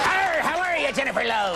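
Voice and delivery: high, growled-out pirate take on Irish; piratical Irish accent. Acted. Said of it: "my Irish accent is always a pirate".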